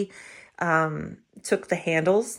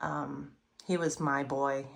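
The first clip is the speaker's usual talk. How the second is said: holding back tears, understated; a touch of tears; 4 semitones lower, 6 dB quieter than usual. Real, not acted. To viewers, remembering her late boxer, softly tearful. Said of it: "I get emotional, I wanna cry".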